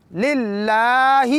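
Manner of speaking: very slow للہ with the long vowel stretched; lengthened lam. Shown as a demonstration. Teacher stretching the word for students, very slow. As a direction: draw out the ل sound in للہ. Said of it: "لام کو تھوڑا لمبا کریں گے".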